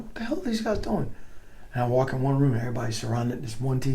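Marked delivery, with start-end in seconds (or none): 0.0-1.1 baffled. Retold or imitated. Retold puzzlement, empty rooms.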